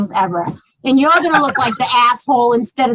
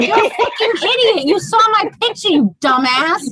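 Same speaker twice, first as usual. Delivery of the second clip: mock angry; 8 semitones higher than usual. Acted insult quote.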